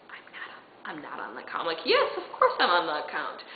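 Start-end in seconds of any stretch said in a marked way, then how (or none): 0.1-1.6 very soft, low mutter; confessional aside. Retold or imitated. Private truth before the lie. 1.9-3.4 full-voiced mock confidence with a laugh; bluffing assurance. Retold bluff to the clerk.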